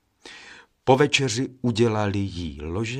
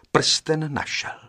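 ordinary delivery, nothing marked

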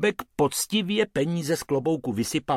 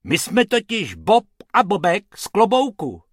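high, loud cartoon rabbit; high-pitched character announcement; little rabbit voice; 9 semitones higher, 6 dB louder than usual. A narrator's reading. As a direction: introduce yourselves brightly as a small rabbit. Rabbit introduces the pair.

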